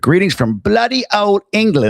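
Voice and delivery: hammed-up British accent, pitched high. Host reading a viewer's greeting from England, mock British accent. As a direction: put on your best mock British accent. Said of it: "It's my best British accent".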